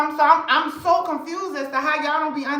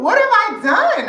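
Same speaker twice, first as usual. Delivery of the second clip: high, louder what have I done; mock outrage; 8 semitones higher, 6 dB louder than usual. Real, not acted. Insisting on her innocence to the haters. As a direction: protest your innocence loudly.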